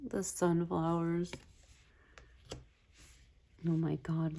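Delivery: ordinary talk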